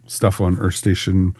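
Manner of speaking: ordinary delivery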